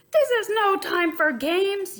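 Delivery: high, loud, slow yell. A narrator's reading. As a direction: goose scolding the little bird. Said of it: "yelled Goose".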